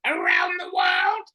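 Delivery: shouted high robotic monotone, slow; Dalek. Shown as a demonstration. Reusing the show title in the impression, robotic shout. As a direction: shriek each word like a machine. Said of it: "I can do a really good Dalek".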